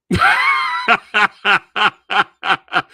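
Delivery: loud high burst of laughter. Real, not acted. Laughing at the film's scene.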